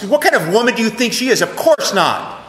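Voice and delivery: retold angry father defending his wife. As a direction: retell his angry rebuttal, fired off quickly. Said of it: "he was very angry about this".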